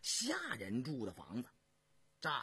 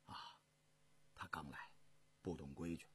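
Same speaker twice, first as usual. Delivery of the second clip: very low, hushed voice; soft excusing; 13 semitones lower, 13 dB quieter, faster than usual. A narrator's reading. Emperor stopping the eunuch's scolding of a newcomer, soft excusing. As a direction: wave it off softly and low.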